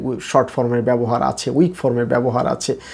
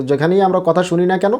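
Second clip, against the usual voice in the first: ordinary talk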